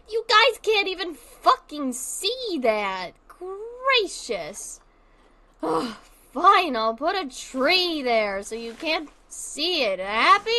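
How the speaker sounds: loud, slow, exasperated grumble at the viewers; fine, have it your way; annoyed sarcasm. Real, not acted. Streamer snapping at her chat, loud and exasperated.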